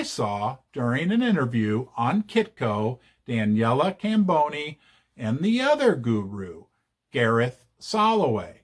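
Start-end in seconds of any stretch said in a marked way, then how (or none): none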